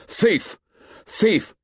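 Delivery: high, stabbing exclamations; heated name-calling; angry accusation. A narrator's reading. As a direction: hurl the insult at him in a high voice. Ralph hurls accusation in quarrel.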